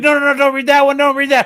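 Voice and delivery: high, loud mock protest; mock alarmed plea. Author begging Jake not to read it, a high loud mock plea. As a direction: cry out to stop him reading. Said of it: "And he's like".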